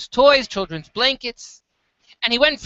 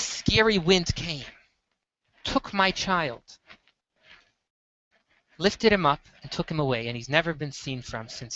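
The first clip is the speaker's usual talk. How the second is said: low, soft and heavy, a mother's loss; hushed grieving mother; 5 semitones lower, 7 dB quieter than usual. Acted. The mother telling the peddler her son was taken, soft grieving voice. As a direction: let the words sink into a hushed grief.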